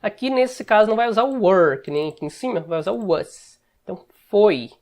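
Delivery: ordinary delivery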